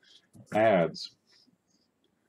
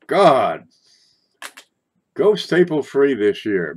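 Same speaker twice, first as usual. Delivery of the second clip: exclamation; 5 semitones higher, 9 dB louder, slower than usual. Real, not acted. Pleading with viewers.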